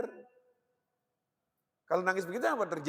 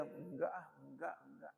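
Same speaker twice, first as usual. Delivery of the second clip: hushed denial; 6 semitones lower, 14 dB quieter than usual. Retold or imitated. Man denying to himself.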